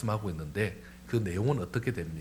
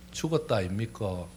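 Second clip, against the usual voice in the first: lightly done Gyeongsang accent; Gyeongsang dialect; 3 semitones lower, 6 dB quieter than usual. Shown as a demonstration. Preacher comparing regional dialects for the congregation, Gyeongsang accent. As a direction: say it like a Gyeongsang local. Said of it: "경상도 말은".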